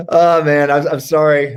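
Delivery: normal talking voice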